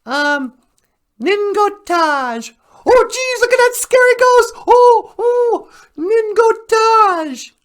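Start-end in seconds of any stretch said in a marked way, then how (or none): 2.8-4.5 higher, louder, fast hammed-up scare; mock fright. Child acting out fear for the Ojibwe word, hammed-up fright. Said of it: "I am afraid". 4.7-7.5 higher, louder, drawn-out pretend fear; mock fright. Child showing the word for afraid, hammed-up fearful. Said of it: "I am afraid".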